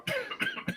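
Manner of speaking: a sudden hammed-up cough at the doctor; fake cough. Pranking the doctor about measles with a fake cough. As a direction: cough hard on purpose to scare him. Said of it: "and I started going".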